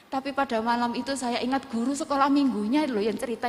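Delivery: ordinary talk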